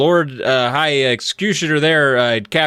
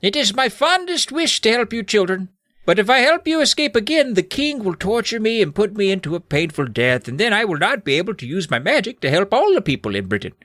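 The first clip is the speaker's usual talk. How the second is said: very high, overwrought wizard voice; hammed-up sorrowful old magician; anguished wizard; 8 semitones higher than usual. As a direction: plead tearfully in a put-on wizard voice.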